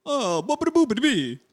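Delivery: playful fake Italian babble; mock Italian accent. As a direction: ham up a cartoon Italian voice. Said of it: "That's not a Japanese accent".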